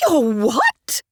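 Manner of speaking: high, loud shocked "Your what?"; horrified screech. A narrator's reading. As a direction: screech it in scandalised horror. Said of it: "his mother screeched in horror".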